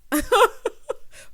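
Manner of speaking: loud, high cackling laugh; high burst of laughter. Real, not acted. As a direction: crack up at the absurd guest. Laughing at Van Jones guest.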